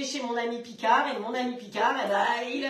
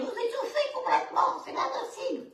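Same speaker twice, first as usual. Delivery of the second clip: choked, voiceless squeak breaking into a laugh; mock choking; 11 semitones higher than usual. Host warns viewers about dry overcooked tuna, mock choking. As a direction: pretend to choke on a dry mouthful.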